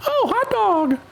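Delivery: very high, squeaky cartoon mouse exclaiming; Mickey Mouse impression. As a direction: chirp it like a cheerful cartoon mouse. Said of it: "Mickey always would say hot dog".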